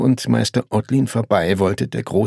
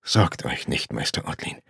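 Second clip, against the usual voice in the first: low, soft, hushed reassurance; quiet and deep, almost murmured; lowered voice; 8 semitones lower, 6 dB quieter than usual. A narrator's reading. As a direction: drop the voice to reassure discreetly. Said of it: "mit gesenkter Stimme".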